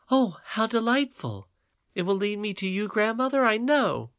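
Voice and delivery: put-on high, eager child's voice full of wonder; a delighted little girl admiring a crown; little princess Irene. A narrator's reading. Irene marvelling to her grandmother, in a high childlike voice.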